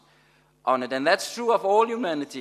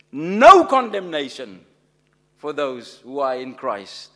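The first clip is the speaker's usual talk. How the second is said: proclaimed loud; 9 dB louder than usual. Real, not acted. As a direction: ring out NO CONDEMNATION much louder. Preacher proclaiming key verse.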